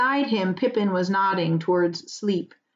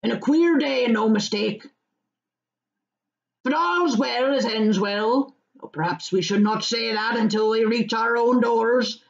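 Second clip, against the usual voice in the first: put-on old farmer voice; 4 semitones higher than usual. Farmer warns the hobbits about fog, rustic voice. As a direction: weigh up the fog like a country farmer.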